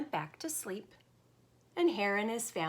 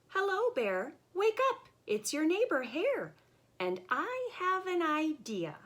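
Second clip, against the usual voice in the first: high, sly hare calling out; cheerful Hare voice; 9 semitones higher than usual. Acted. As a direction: wheedle Bear awake, bright and high.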